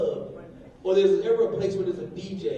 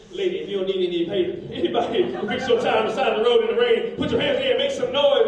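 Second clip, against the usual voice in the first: mock DJ hype; 4 semitones higher, 7 dB louder than usual. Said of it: "I said".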